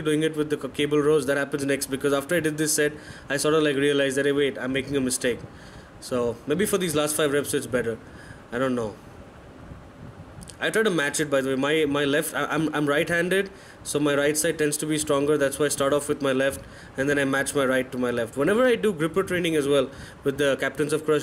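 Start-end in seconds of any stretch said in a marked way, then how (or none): none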